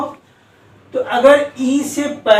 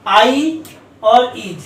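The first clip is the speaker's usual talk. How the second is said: slow emphatic answer; much slower than usual. Shown as a demonstration. Teacher to students, drilling the rule very slowly. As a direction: answer own question very slowly, word by word. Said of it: "क्या रहना चाहिए यहाँ पर?".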